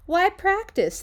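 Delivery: put-on high, loud whine of lazy boys; whiny kids.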